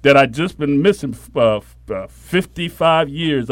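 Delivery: ordinary talk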